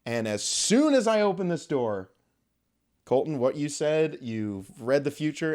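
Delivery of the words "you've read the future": normal talking voice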